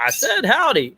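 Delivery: high-pitched, indignant mock retort; Brer Rabbit insisting. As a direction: imitate a cartoon rabbit getting testy. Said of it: "Brer Rabbit gets mad".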